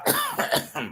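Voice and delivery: high strangled cough; real choking cough. Real, not acted. Host still choking, strangled high cough. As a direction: cough hard while choking.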